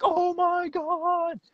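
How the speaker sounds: very high, slow gushing squeal; excited fan squeal. Retold or imitated. Young fans swarming him, squealing in excitement. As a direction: shriek it like a starstruck fan.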